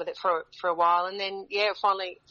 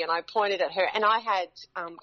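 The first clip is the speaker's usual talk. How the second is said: usual speaking voice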